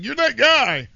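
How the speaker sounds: loud, high excited stranger; excited fan. Retold or imitated. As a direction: blurt it out like a starstruck fan. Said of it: "the guy's like".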